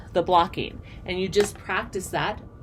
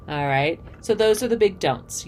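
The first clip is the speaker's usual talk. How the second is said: ordinary talk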